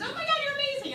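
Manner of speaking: high, gushing squeal of praise; fawning over a hero; gushing fan voice. Pretend. Fan gushing to Oprah, high squeal. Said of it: "I was like".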